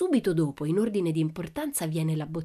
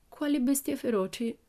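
high, timid question; frightened; 7 semitones higher than usual. A narrator's reading. Annika groans and asks about wild beasts, frightened. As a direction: ask it in a small, high, fearful voice. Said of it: "chiese poi con voce".

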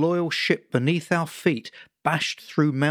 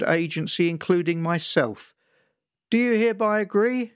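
higher bureaucratic radio voice; police dispatcher; 5 semitones higher than usual. Retold or imitated. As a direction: rattle off the legal disclaimer like a dispatcher. Cadet reads liability disclaimer on radio.

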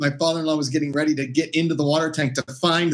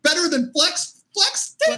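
incredulous high squeak; 13 semitones higher, slower than usual. Retold or imitated. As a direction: voice jumping way up in disbelief. Retold incredulous reply.

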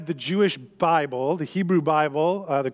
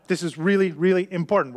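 normal talking voice

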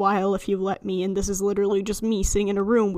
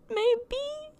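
high squeak; 14 semitones higher, 4 dB quieter than usual. Real, not acted. Unsure aside on timing.

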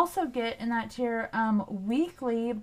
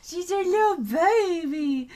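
high-pitched cooing over the dog; 7 semitones higher, 4 dB louder than usual. Real, not acted. Owner fussing over her little dog, high baby talk.

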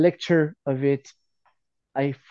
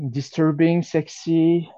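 ordinary delivery

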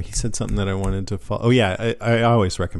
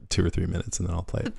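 normal talking voice

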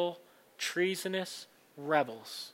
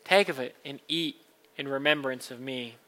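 liturgical reading; 8 dB louder than usual. Acted. Words of institution.

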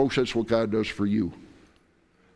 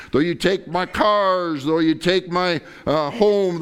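louder, rising preacher's build; fervent preaching; 7 semitones higher, 5 dB louder than usual. Acted. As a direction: pile each line higher in devotion.

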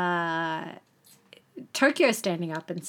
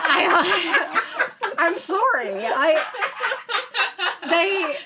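high, loud, breaking up with laughter; laughing helplessly; 10 semitones higher, 4 dB louder, much slower than usual. Real, not acted. Host apologising to co-hosts for cracking up, laughing. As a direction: try to speak through a laughing fit.

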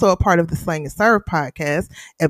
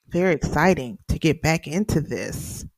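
ordinary talk, nothing marked

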